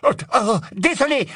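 high, flustered apology; backing down in a fluster; stammering apology. A narrator's reading. The cowed wizard apologising to his superior, stammering. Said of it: "balbutia le sorcier".

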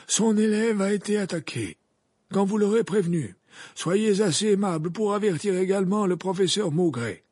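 put-on high, loud, commanding old wizard; urgent Dumbledore. Dumbledore gives Hagrid his errands, urgent.

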